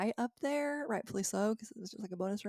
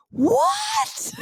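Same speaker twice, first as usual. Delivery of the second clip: high, loud squeal of disbelief; stunned disbelief; 9 dB louder than usual. Real, not acted. Learning her husband simply picked the lock, she squeals in disbelief. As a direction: shriek it as if nothing makes sense.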